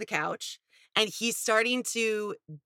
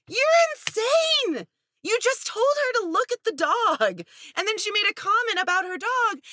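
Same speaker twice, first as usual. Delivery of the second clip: much higher, louder outrage; outraged disbelief; 12 semitones higher, 3 dB louder than usual. Real, not acted. Viewer outraged at the boyfriend on a reality show. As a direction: shout at the screen in disbelief. Yelling at the TV.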